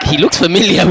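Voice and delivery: high, loud, laughing through the words; laughing quote. Retold or imitated. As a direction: giggle it out, half amused. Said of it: "I was like".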